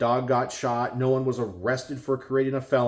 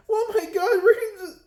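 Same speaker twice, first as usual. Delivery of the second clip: high, hammed-up panicky squeal; mock panic; 4 dB louder than usual. Mocking panicked gun owners, hammed-up high squeal. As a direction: shriek it like a mock freakout. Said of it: "They're like".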